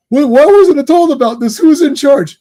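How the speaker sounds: mock indignant commissioner; indignant outburst. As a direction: imitate Gordon shouting who's in charge. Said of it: "Commissioner Gordon's like".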